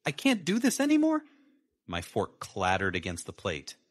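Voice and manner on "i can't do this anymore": a much higher, tight woman's voice; Sarah forcing the words out, strained. A narrator's reading. Wife ending the marriage at dinner, tight and high. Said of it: "her voice tight".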